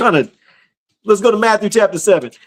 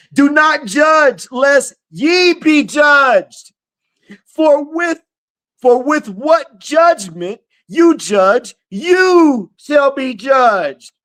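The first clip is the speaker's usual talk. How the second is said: slow, raised, ringing sermon read; preaching scripture; 9 semitones higher, much slower than usual. Acted. Preacher proclaiming Matthew seven to his livestream, slow and ringing. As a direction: proclaim the verse to the congregation.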